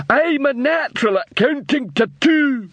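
high, slow troll repeating proudly. A narrator's reading.

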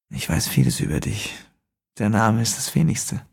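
leise, raunende Fremdenstimme; düster und gedämpft aus dem Schatten; unheimlicher Fremder. A narrator's reading. Fremder spricht. (geheimnisvoller Fremder im Dunkeln, leise raunend)

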